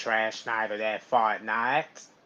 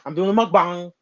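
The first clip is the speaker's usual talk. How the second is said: imitating Uncle Lewis; 5 semitones higher, 4 dB louder, faster than usual. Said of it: "Like Uncle Lewis would do".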